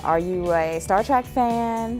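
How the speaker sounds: ordinary delivery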